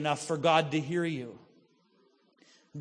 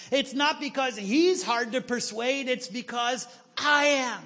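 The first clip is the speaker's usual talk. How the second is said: higher, louder, driving sermon delivery; preaching at full force; 6 semitones higher, 4 dB louder than usual. Acted. Preacher drives home the point to the church, full force. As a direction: preach it out to the congregation.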